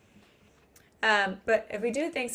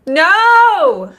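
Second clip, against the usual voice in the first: high, very loud drawn-out cry; outraged refusal; 10 semitones higher, 19 dB louder than usual. Real, not acted. Co-host hearing the redemption arc theory, loudly outraged. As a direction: belt out a long, appalled refusal.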